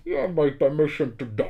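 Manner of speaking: hard-to-understand film villain voice; Bane. Acted. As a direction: speak through a hand over your mouth. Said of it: "quand il dit, euh".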